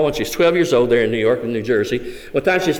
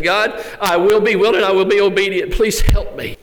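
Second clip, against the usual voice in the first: a desperate first prayer, begging; pleading prayer; 6 semitones higher than usual. Retold or imitated. Jane praying to God in her cell, mild pleading.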